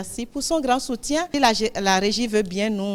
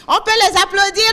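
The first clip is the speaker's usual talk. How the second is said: high, loud call for applause; rally the room to clap; loud call to applaud; 9 semitones higher, 8 dB louder than usual. Real, not acted. Host calls for applause. (appel fort à applaudir)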